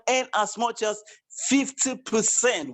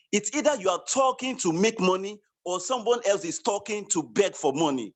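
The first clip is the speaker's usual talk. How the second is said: ordinary talk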